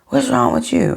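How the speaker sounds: dismissive snap. Retold or imitated. Boyfriend voiced dismissively.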